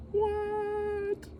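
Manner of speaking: very high drawn-out what; shocked squeal. Acted. Children shocked at Uncle Ned's fate, high squeal. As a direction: squeak it out in disbelief.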